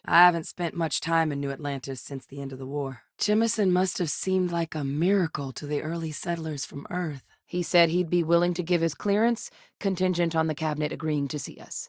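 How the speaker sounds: ordinary talk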